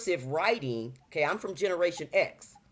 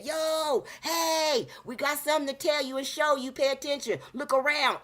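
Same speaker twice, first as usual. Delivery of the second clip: much higher, bright spirit voice calling out; 9 semitones higher than usual. Acted. The universe's energy urging the listener, in a high calling voice. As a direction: play a cosmic energy urging you to pay attention. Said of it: "a high vibrational energy trying to say".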